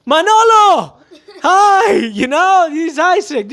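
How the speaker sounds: high, loud mock shout across the store; high shouted hello across a line; screaming greeting. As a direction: yell a greeting over a crowd. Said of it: "I cannot be screaming like".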